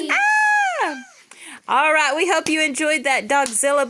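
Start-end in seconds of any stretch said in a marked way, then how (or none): 0.1-1.0 high loud mock scream. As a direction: scream in pretend terror. Mock terror at puppies.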